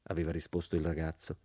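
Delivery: plain reading voice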